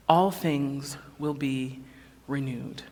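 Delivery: slow and soft, settling; slow gentle close. Acted. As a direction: let it land gently and slowly. Slow quiet close of refrain.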